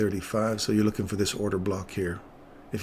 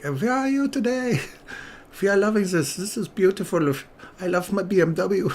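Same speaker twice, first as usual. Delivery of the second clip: put-on German accent; 10 semitones higher than usual. Bikers greeting people in a mock German accent. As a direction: play a cheerful German biker. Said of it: "So everything had a German accent".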